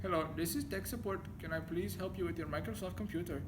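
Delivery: mock Indian accent for a scam call. Scahill fakes an Indian accent to a scam caller. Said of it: "he was talking in an Indian accent".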